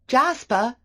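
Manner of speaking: loud, high shout of the name. A narrator's reading. Calling the disobedient dog on the beach, loud shout. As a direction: yell across the beach to the dog. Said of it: "I shouted".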